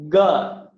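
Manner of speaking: ga with no breath after it; unaspirated ga. Shown as a demonstration. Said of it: "you should feel no breath".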